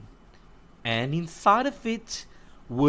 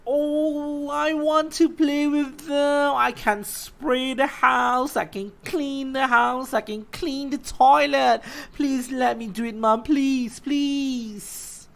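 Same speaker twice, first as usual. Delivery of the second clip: put-on a higher, eager child's voice; lightly voiced little girl; 9 semitones higher than usual. Lucy pleading with her mom for cake, eager and higher. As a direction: sound like a keen small girl.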